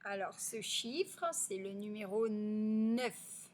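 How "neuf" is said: NEUF with a long held n; elongated initial n. Shown as a demonstration. Teacher has pupils hear n, "neuf" drawn out. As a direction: sustain the n before "neuf" opens. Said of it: "le son ne".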